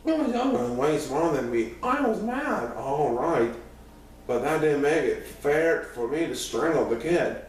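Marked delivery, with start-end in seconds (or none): none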